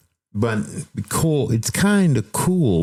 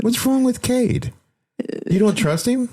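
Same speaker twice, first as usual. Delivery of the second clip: high teasing voice. Real, not acted. Teasing partner about AI.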